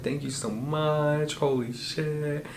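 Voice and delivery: a touch of mock sobbing, drawn out slow; crying thanks. Dreamer thanks a friend for a big check, tearfully overwhelmed. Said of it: "like, crying".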